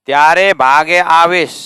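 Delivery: loud, high त्यारे भागे आवेश in one group; emphatic phrase grouping. Shown as a demonstration. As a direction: punch "त्यारे भागे आवेश" all through. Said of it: "कौन कंस्ट्रक्शन है".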